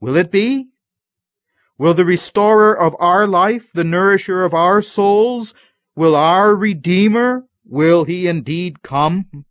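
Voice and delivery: high, loud, anxious pleading; anxious pleading question. Retold or imitated. Believers in darkness plead with God, high and anxious. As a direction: cry out in doubt, begging for an answer. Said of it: "And we ask".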